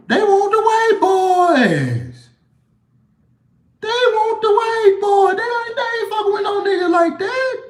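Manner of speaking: high, slow, shouted mock voice; mock club voice. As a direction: ham up the club's demand, way up high. Mocking the club's preference.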